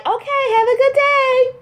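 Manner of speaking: high, singsong mock-cheerful farewell; chirpy sign-off. Mom texting her busy son, mock-cheery.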